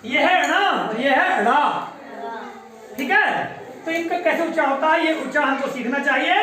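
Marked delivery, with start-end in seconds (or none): 0.0-1.5 slow contrast demo. Shown as a demonstration. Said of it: "ण का उच्चारण कैसे होता है".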